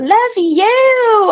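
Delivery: squeaky high love you; high, bright-voiced love you to a viewer; cutesy love you. Real, not acted. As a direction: toss a sugary love you to the chat. Blowing love to chat.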